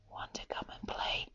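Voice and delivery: breathy unvoiced whisper. Kiwi asking the sleeping kakapo to play, whispered. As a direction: whisper the invitation to the sleepy friend. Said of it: "little Kiwi whispered".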